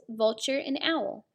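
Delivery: usual speaking voice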